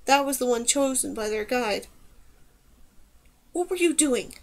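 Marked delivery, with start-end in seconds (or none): 3.5-4.4 higher-pitched, restrained demand; mildly accusing, held in; soft demand. A narrator's reading. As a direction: question insistently without raising the volume. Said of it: "Kadar demanded softly".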